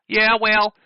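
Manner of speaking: high, hammed-up Southern drawl; mock Southern voice. Streamer reading his rival's message in a mock Southern accent.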